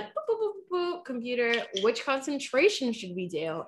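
high beeping then robotic ask; computer beeps. Pretend. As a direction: beep like a machine, then ask. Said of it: "So then we're like".

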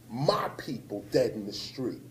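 a stunned whisper after turning on the news; grave whisper. Real, not acted. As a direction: breathe it out in a whisper. Grim news recalled.

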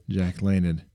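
usual speaking voice

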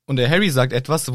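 ordinary talk

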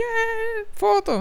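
high, soft mock squeal; imitated giddy little cry; eager squeal. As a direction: excitedly ask in falsetto. Acts out asking for a photo.

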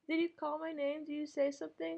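calling from the bathroom; distant voice through a door; from another room. Acted. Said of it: "I'm in the bathroom".

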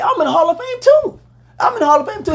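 high, indignant mock protest; imitating Shannon. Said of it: "he told Skip Bayless".

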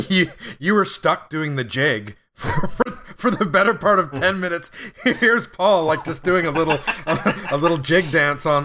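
giggling while telling it; laughing. Real, not acted. Laughing while retelling glitch.

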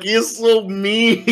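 slow, laughing high; giggling in disbelief; amused laughing. Retold or imitated. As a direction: laugh it out, delighted. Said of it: "I just started laughing".

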